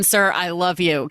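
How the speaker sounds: ordinary talk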